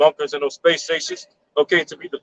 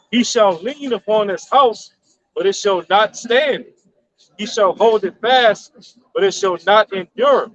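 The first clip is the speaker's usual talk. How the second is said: slow, higher-pitched Bible reading; scripture reading; 5 semitones higher, slower than usual. Acted. Reading Job in the King James, slow scripture reading. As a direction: declaim the verse in slow, measured phrases. Reads a Bible verse aloud.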